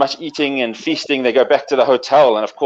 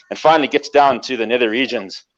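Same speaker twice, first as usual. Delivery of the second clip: normal talking voice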